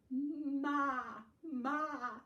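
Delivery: playful loud goat call; goat bleat. Acted. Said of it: "said the goat".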